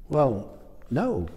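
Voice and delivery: normal talking voice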